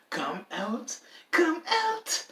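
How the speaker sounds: high whispered taunting call; creepy sing-song taunt. Retold or imitated. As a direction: whisper then squeal come out. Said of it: "I know it doesn't sound right with me doing that".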